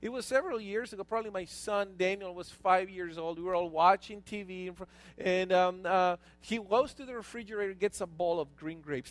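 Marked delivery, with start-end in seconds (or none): none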